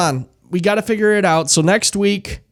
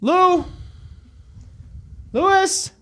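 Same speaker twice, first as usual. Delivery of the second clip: calling the dog; 13 semitones higher, 4 dB quieter, much slower than usual. Real, not acted.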